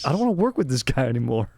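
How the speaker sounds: mock complaint aimed at the audience; Steve Harvey. Host complaining to camera about rowdy guest, mildly mock upset. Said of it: "He looks at the camera like".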